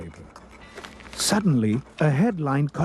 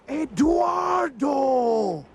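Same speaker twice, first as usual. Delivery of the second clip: high, drawn-out announcing shout; grand reveal; 13 semitones higher, much slower than usual. A narrator's reading. Narrator revealing who popped out, triumphant cry. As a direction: herald the star with a flourish. Dramatic reveal of the missing singer.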